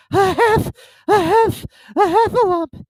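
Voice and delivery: breathless high squeal, stuttering toward the word; Piglet. A narrator's reading. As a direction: force it out in loud, panting bursts. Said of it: "breathing so hard that he could hardly speak".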